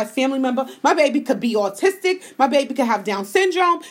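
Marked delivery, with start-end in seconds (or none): none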